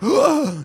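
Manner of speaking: loud, high shocked gasp; hammed-up theater gasp; mock gasp. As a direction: gasp like a stunned moviegoer. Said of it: "And you're like".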